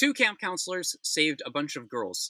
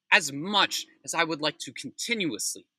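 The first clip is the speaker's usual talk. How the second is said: normal talking voice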